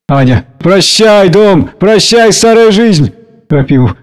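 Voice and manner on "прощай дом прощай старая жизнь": high, slow, drawn-out goodbye; exclaimed farewell. A narrator's reading. Anya leaving her old life, exclaimed farewell. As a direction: call goodbye, stretching the words.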